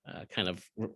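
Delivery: ordinary delivery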